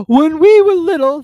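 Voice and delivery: slow, loud, high mournful voice; hammed-up teary turtle; sobbing a little. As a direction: speak through the sobs. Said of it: "more calmly, though ... sobbing a little".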